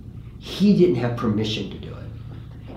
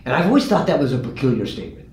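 ordinary talk, nothing marked

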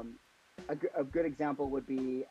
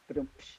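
whispered drum rimshot; rimshot sound; 6 dB quieter than usual. Retold or imitated. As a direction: mouth a ba-dum-tss. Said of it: "whenever I make a joke in my head".

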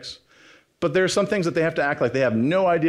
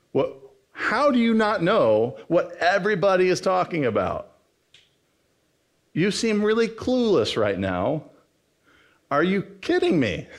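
higher-pitched, emphatic mock astonishment; exasperated disbelief; 5 semitones higher than usual. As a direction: imitate someone who cannot believe such ignorance. Acted disbelief at clueless stranger.

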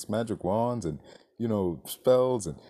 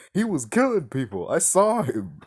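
retold scream; 5 semitones higher, 5 dB louder than usual. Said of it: "is screaming like".

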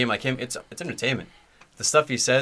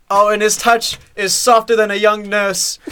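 slow, high, loud quoted rapture; commentator impression; 9 semitones higher, 5 dB louder, slower than usual. Podcast guy quoting a commentator's odd line, rapturous impression. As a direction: relish the odd simile like an excited commentator. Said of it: "Dude, he sa-, he said".

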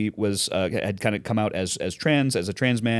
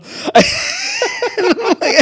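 loud laughing shout; 7 dB louder than usual. Real, not acted. After Goliath impression.

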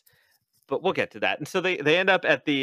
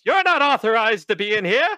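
high, loud flustered security guard; hammed-up guard impression, badly done; terrible Don Knotts; 10 semitones higher, 6 dB louder than usual. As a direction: shout it high like a comic little official. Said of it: "That was a terrible Don Knotts impersonation".

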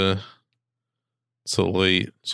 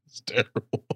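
ordinary talk

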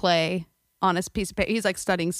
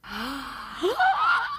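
mock gasp; 10 semitones higher than usual.

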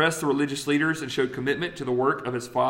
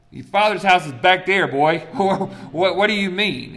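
parental scolding; 4 semitones higher, 6 dB louder than usual. Retold or imitated.

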